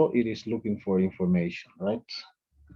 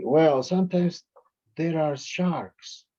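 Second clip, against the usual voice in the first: slow answer; 6 semitones higher, slower than usual. Shown as a demonstration. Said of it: "And they will say".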